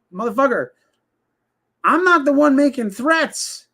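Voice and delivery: high, sharp comeback, voice jumping up; high-pitched indignant snap; exasperated retort. Retold or imitated. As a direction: fire it back, incredulous and heated. Retelling his own comeback.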